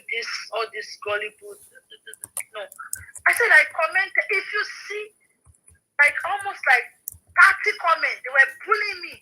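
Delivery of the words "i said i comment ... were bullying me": heated retelling. Real, not acted. Retelling being bullied online.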